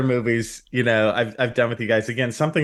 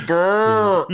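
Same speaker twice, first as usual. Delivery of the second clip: rolled lips brr, laughing; giggly lip trill noise; 9 semitones higher, 4 dB louder than usual. Retold or imitated. Laughing imitation of a sound.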